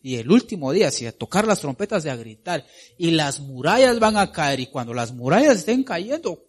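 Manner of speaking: mild voice of Joshua ordering his troops; Joshua commanding. Acted. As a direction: give the battle orders like a general. Preacher voicing Joshua's command.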